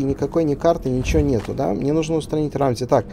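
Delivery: ordinary talk, nothing marked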